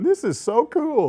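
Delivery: high, slow, loud mock thrill; hammed-up delight at the player intros; mock excited. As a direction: gush like a kid watching old TV. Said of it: "and you're going".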